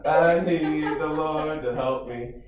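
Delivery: ordinary talk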